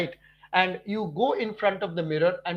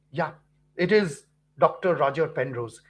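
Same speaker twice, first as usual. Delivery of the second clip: ordinary talk, nothing marked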